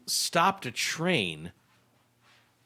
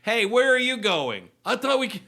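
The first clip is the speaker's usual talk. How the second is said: loud and high, calling after; 10 semitones higher, 5 dB louder than usual. Pretend. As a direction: shout after the leaving passengers. Passenger calling after others.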